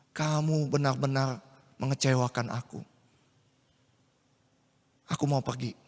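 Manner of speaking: low, quiet, heavy disappointment; disappointed Yusuf. Acted. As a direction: let him sink into hurt. Yusuf disappointed, leaving Maria. (Yusuf kecewa meninggalkan Maria, suara rendah pelan)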